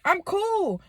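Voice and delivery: high, breezy kid voice playing it cool; nonchalant child me. Child telling the family she's fine, breezy and high. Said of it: "So I'm like".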